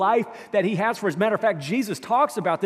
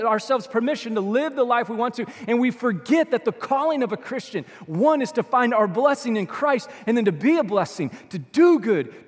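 louder, driving sermon delivery; full-force preaching; 5 semitones higher, 5 dB louder than usual. Acted. Pastor rousing the congregation, loud impassioned preaching. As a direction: preach it hard to the room.

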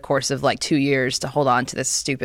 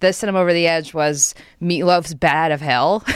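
ordinary talk

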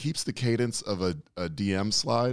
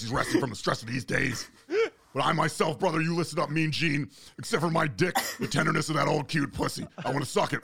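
Hulk Hogan voice; 8 semitones higher, faster than usual. Retold or imitated. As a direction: ham it up like a wrestling promo. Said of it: "in the Hulk Hogan voice".

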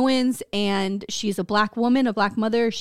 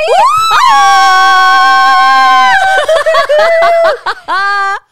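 shrieking burst of laughter, much higher and louder; screaming laugh; 12 dB louder than usual. Real, not acted. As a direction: lose it completely with a howling laugh. Explodes laughing at the joke.

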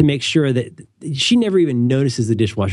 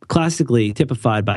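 usual speaking voice

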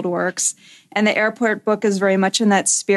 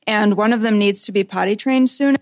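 usual speaking voice

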